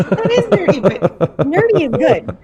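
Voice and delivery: high, loud, laughing endorsement; laughing approval. Real, not acted. Friend reassuring the self-declared nerd, laughing loudly. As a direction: laugh hard while cheering it on.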